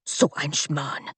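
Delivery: soft, slow German curse under the breath; muttered curse. Acted. Bodyguard cursing to himself in German, soft and slow. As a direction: grumble it to yourself. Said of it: "Curse whispered".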